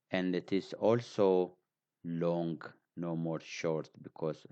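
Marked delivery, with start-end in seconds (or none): none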